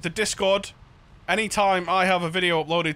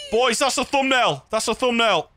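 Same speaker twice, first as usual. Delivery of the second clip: excited; 5 semitones higher, 4 dB louder than usual. Real, not acted. Excited about the view.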